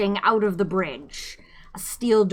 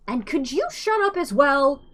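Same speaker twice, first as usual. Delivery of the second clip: snapped; 7 semitones higher than usual. A narrator's reading. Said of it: "snapped Ford".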